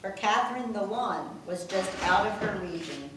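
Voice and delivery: reading rhyming verse aloud; steady sing-song poem read; poem recital. Acted. Daughter reads the family poem to her mother, recital voice.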